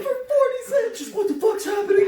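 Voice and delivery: very high, loud hammed-up panic; nonstop screaming. Brother screaming through the forty-second ride, nonstop screaming. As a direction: imitate relentless terrified yelling, laughing as you go. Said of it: "doesn't stop screaming until the ride is over".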